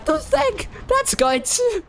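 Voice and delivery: pushed so hard the voice breaks; voice cracking. Real, not acted. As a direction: voice cracks up high mid-call. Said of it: "So I'm going to lose my voice".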